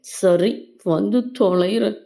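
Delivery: fine, I'll come, said unwillingly; grudging agreement. Acted. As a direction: give in with mock annoyance. Reluctantly agreeing to friend's pleading.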